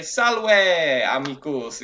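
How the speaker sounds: loud, drawn-out Latin greeting; hammed-up Roman shopper's hello; ancient Roman greeting friends. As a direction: hail a friend in the street in Latin, stretching the words. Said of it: "they would say".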